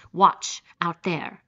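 a brief, emphatic call to look; exclaiming. A narrator's reading. General pointing his guest to the night sea, exclaiming. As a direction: punch the first word loudly, then ease. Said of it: "exclaimed the general".

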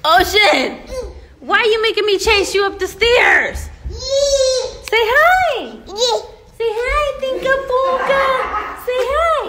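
loud high coaxing. Real, not acted. Mother chasing her toddler up the stairs, loud and playful. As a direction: call him down loud and playful.

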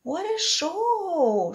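put-on high, slow child's cheer; shouted boy. A narrator's reading. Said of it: "shouted a boy".

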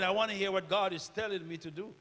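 usual speaking voice

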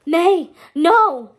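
loud, high panicked scream. Acted. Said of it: "Hector screamed in a panic".

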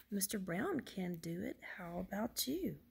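lively sing-song picture-book reading; reading to children. Acted. Woman reads Mister Brown book to children, lively storytelling. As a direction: read it with big swoops of pitch.

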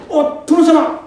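startled cry, high and loud; surprised. Acted. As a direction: gasp out the lord's title in shock. Young man suddenly meets lord.

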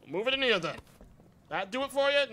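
normal talking voice

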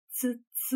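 quiet, gentle tsk-tsk of disbelief; soft doubtful tut-tut. A narrator's reading. Detective clicking his tongue at the story, soft tut-tut. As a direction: click the tongue softly, unconvinced. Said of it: "Era un sunet blând, neîncrezător".

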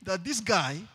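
loud, high sermon cry to the church; preaching at full force. Acted. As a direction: preach it out, pushing every word.

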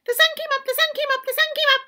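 high, happy squirrel chant; high singsong squirrel chant. Acted. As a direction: chant it joyfully like a tiny squirrel. Squirrel celebrates.

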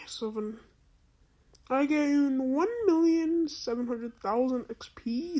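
ordinary delivery

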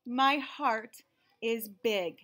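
usual speaking voice

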